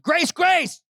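sudden loud, high cry; shout. Acted. Preacher acting out Jesus shouting at Judas, loud shout. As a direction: burst out shouting both words. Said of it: "looked at Judas and shouted".

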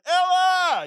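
loud, high call across the hills; shepherd calling out into the distance; hollering a name. Retold or imitated. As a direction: holler for the lost sheep. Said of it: "he's hollering".

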